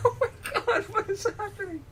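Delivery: high, breathless laughing disbelief. Real, not acted.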